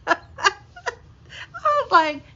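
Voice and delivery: words squeezed out through laughter; can barely get it out for laughing; slow, wheezing laugh through the words. Real, not acted. Laughing while retelling awkward moment.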